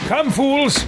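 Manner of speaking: high, imperious rallying cry; pompous battle summons; haughty commander. Acted. Haughty character rallies party.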